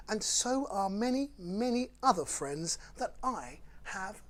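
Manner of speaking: lively, sing-song picture-book read; bouncy storytime rhyme reading; reading to children. Acted. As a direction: read it like bedtime fun for little ones. Reads Dr Seuss to children.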